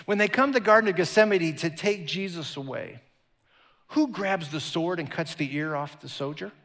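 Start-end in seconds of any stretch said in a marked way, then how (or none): none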